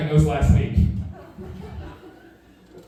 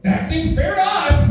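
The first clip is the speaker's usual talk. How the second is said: jumps way up high, eager to please; squeaky agreement; 13 semitones higher than usual. Retold or imitated. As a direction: squeak it out like a relieved kid. Eager to accept detention.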